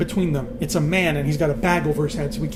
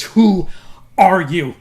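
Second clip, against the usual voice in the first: loud, raised demand with WHO split off; forceful demand; 3 semitones higher, 9 dB louder, much slower than usual. Acted. Queen slaps Jon and demands an answer, loud and forceful. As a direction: demand it loudly, pausing after the first word. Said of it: "and she slaps him".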